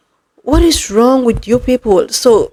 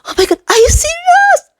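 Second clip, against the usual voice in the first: much higher, delighted squeal at good news; excited shriek. Retold or imitated. Vlogger acting out her joy at a phone call, thrilled squeal. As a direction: squeal it like you just got great news on a call. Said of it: "I was like".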